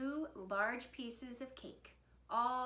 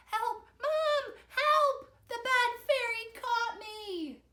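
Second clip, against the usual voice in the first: high, loud cry for rescue; shrieking; 14 semitones higher, 9 dB louder, slower than usual. A narrator's reading. Victor caught by Bad Fairy calls Mom, shrieking. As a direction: shriek for help in panic. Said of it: "Victor shrieked".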